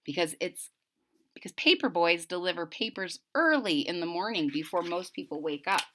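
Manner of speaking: ordinary delivery, nothing marked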